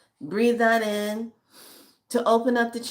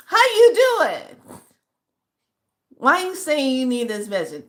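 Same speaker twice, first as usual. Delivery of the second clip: excited greeting; 7 semitones higher, 6 dB louder than usual. Real, not acted. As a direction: greet her with a big happy lift. Greeting the follower warmly.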